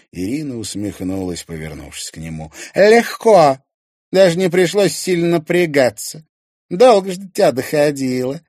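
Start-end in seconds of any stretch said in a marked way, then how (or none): 2.7-8.4 high, loud mocking taunt; pitched-up smug scorn; gloating. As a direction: rub it in, pleased with yourself. Irina taunts Timofey over scheme.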